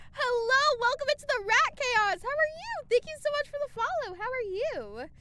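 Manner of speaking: high, loud cheery welcome; bright greeting. Real, not acted. Streamer excitedly welcoming a new viewer. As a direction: greet the newcomer with delight.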